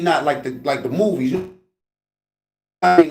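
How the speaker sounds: ordinary talk, nothing marked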